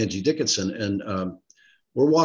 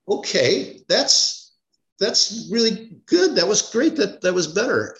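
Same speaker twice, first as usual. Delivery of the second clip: higher-pitched, drawn-out mock approval; hesitant approving directors; 8 semitones higher, slower than usual. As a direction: play directors slowly granting it was better, pitched up. Directors react to the cut scene.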